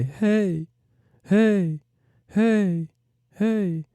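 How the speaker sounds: high heys fading like a delay; repeating echo hey. Shown as a demonstration. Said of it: "That would be the delay".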